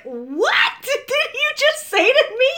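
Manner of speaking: very high, slow squeal of disbelief; incredulous. Retold or imitated. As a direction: squeal it, drawn out, half laughing. Acts out shock at stranger's remark.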